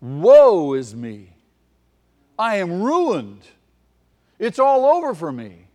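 loud, slow wail of dread; Isaiah before God's vision, crying woe; cried out in terror. Retold or imitated. Prophet seeing God in the temple, terrified loud outcry. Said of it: "Isaiah cried out in terror".

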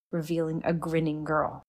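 ordinary delivery

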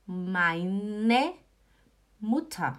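very slow, each word stretched out; patient teacher drawing out the German; slow model answer. Shown as a demonstration. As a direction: model it slowly for beginners. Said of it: "Aí a resposta é".

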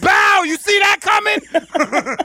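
high, slow hammed-up shout; mock taunt. Host joking he punched her, shouting a hammed-up taunt. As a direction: bellow it like landing a punch. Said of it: "I was like".

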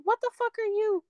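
very high, affronted outburst; incredulous outrage. Retold or imitated. Woman acting out her reaction to repeated texts, incredulous. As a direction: shoot the pitch up in disbelief.

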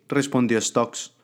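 plain reading voice

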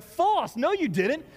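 high, indignant mock rebuttal; teasing a friend who rewrites history; playful protest.

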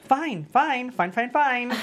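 loud, high clipped repeats of fine; insisting fine. Acted. Jordan to Stephanie, loudly insisting he is fine. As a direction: brush her off, insisting. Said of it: "people who repeat fine five times".

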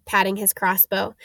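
usual speaking voice

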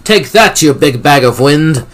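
high, loud taunt; gleeful battle shout; triumphant yell. A narrator's reading. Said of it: "Cassie yelled".

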